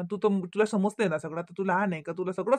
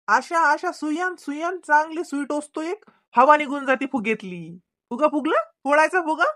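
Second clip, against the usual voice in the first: put-on high, sing-song teasing of a pouting child; playful teasing; 7 semitones higher, 3 dB louder than usual. Dad teasing his pouting daughter, high and playful. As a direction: coax her out of the sulk, up high.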